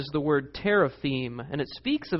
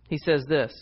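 ordinary delivery

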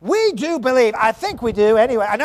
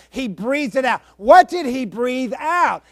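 ordinary talk

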